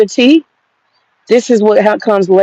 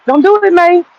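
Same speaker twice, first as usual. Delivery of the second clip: same warning, pitched much higher and pressed; emphatic warning; 9 semitones higher than usual. Retold or imitated. Warning himself off the elderberry, urgent and much higher. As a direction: repeat the warning, jumping up high on it.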